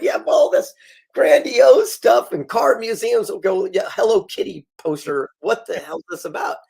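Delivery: high-pitched retold incredulity; mock bafflement. Puzzled interviewer questioning the out-of-place poster, incredulous.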